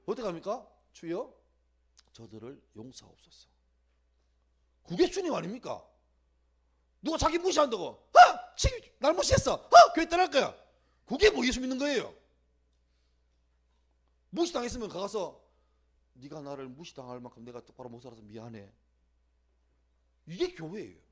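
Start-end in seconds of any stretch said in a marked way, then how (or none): 0.9-3.5 낮고 작게 간구; 십자가 위에서 조용히 빌듯; 나직한 용서 기도. Acted. 십자가 위 예수의 기도. 7.8-10.5 아주 높고 크게 발끈; 무시당했다고 따지며 소리치기; 발끈한 교인. Acted. 무시당해 발끈한 교인 흉내. 16.2-18.7 낮고 작게 내리깐 사과; 자기 탓이라며 조용히 뉘우치듯; 나직한 사과. Acted. 상대에게 사과하는 본보기.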